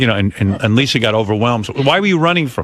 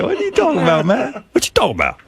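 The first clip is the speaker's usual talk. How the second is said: mock sitcom catchphrase; 9 semitones higher than usual. Arnold catchphrase impression.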